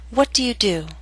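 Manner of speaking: "What do you do?" falling in pitch at the end; falling intonation. Shown as a demonstration. Teacher models question to repeat, falling intonation. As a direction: start up on "What", drop on "do". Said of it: "practice intonation patterns and questions".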